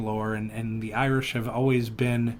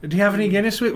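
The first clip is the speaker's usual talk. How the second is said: higher, louder eager host voice; fawning officer; 7 semitones higher, 5 dB louder, much faster than usual. Retold or imitated. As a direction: offer the drink sweetly. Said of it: "they're like".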